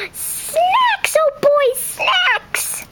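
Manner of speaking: high, gasping, squealing delight; thrilled gasp. Acted. Clarice thrilled to be offered cookies, squealing. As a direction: squeal over the snacks. Excited about snacks.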